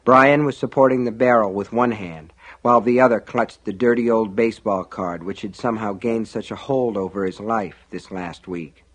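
usual speaking voice

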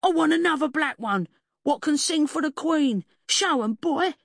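high, loud indignant cockney; high-pitched wronged dog owner; squealing demand. A narrator's reading.

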